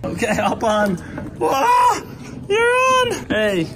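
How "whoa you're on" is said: high, excited whoa; surprised. Real, not acted. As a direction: cheer her on. Child gets onto the bike.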